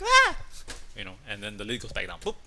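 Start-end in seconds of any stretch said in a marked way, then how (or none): 0.0-0.4 very high, loud startled shriek. Retold or imitated. Wife sees eyes under the lid, shrieks. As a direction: scream as if something jumped at you. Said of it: "So she's like".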